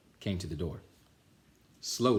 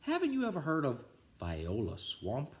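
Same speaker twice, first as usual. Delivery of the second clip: lightly voiced older kid, a bit higher; higher teasing kid; big kid voice; 6 semitones higher than usual. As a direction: tease the little kids.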